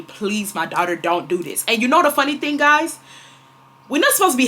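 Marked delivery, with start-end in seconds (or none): 0.0-1.6 soft, lowered, gentle plea; tender, hushed divine warning; calm voice of God. Retold or imitated. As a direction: plead quietly, dropping pitch and volume. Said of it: "I heard God telling me".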